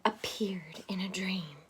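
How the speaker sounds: hushed dreamy whisper. A narrator's reading. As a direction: whisper it like a secret. Dream appears.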